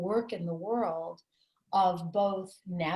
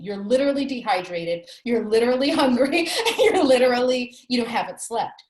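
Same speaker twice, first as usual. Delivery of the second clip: louder, laughing, a bit higher; rueful laugh while listing what grief does; laughing through it; 4 semitones higher, 9 dB louder than usual. Real, not acted. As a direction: laugh at how absurd it gets. Laughing list of grief's effects.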